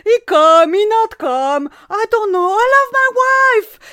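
loud high mock Italian broken English; fake Italian accent. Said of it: "je parlais faux italien".

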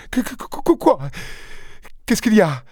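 bégaie, souffle chuchoté puis très aigu; bégaiement épouvanté. Acted. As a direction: bute sur les mots, terrifiée. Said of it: "épouvantée, bégayait". (épouse épouvantée qui bégaie, voix très aiguë)

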